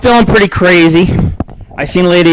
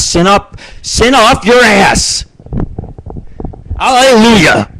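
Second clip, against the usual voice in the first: hammed-up preacher, slow and higher; mock church sermon; 7 semitones higher, slower than usual. Radio host jokingly preaching to his listeners, hammed-up sermon voice. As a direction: preach it like a mock revival, stretching the words. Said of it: "starting to sound like a church sermon".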